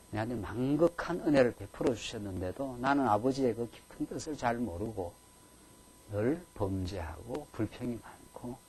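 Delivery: soft, slowed, contrite prayer; a penitent believer's hushed lament; mournful confession. Retold or imitated. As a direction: confess in quiet sorrow. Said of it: "애통하는 마음으로 나아가는 것입니다".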